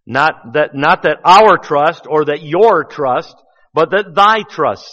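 slow, loud contrast landing on "thy"; stress on thy. Shown as a demonstration. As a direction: lean on "thy" over "our" and "your".

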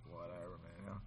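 low, mumbled whatever man; mocking teen. Preacher imitating a teen dismissing his parents. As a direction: brush it off like a bored teenager. Mimics teenager brushing off parent.

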